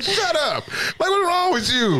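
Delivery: high-pitched, incredulous mock telling-off; comic exasperation without extra volume; retold scolding. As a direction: re-enact a fed-up dad, voice way up. Retold scolding of crying son.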